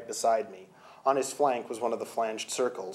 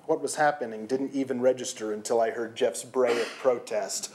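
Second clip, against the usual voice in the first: ordinary delivery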